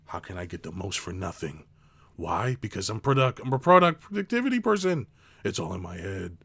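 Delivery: low, smug imitation of a greedy boss; lightly mocking a productivity boss in a low voice; mock boss thoughts. Voicing a boss's thinking.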